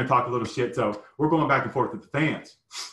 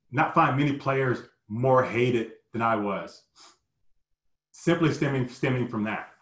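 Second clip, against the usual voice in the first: ordinary talk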